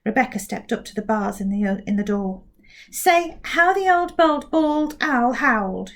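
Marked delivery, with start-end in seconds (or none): 2.9-5.9 slow, careful twister, pitched up; carefully. Acted. Rebecca carefully gives the mouth her twister. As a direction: set each word down with care. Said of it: "she said carefully".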